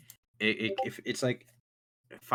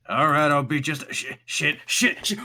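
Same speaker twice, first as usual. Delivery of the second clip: higher and louder, cursing in a fluster; gruff flustered Gerald; 6 semitones higher, 8 dB louder than usual. Acted. Gerald rushing to answer the door, flustered swearing.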